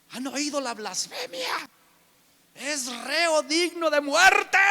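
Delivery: high, loud cry of outrage; outraged accusers. Retold or imitated. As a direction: shout out the blasphemy charge.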